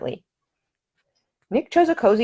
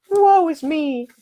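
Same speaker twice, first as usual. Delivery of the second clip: high, slow melodramatic heroine; mock swoon; 12 semitones higher, 6 dB louder, much slower than usual. A narrator's reading. Ida teasing Nick, hammed-up melodramatic lament. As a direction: ham up a tragic damsel. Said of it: "in a mock swoon".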